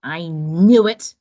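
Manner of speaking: fed-up snap. Acted. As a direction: spit it out, disgusted at the excuse. Iguana fed up.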